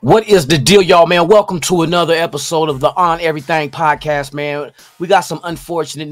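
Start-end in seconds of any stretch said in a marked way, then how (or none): 0.0-4.7 loud, hyped show opener; podcast intro. Acted. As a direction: greet the listeners like a host. Podcast host opens the show.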